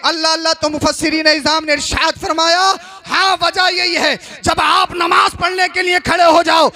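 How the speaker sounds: forceful, ringing sermon delivery; emphatic Urdu sermon oratory; impassioned preaching. Real, not acted. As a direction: preach it to a whole congregation, phrase by phrase. Resumes full-force preaching.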